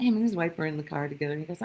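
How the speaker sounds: ordinary delivery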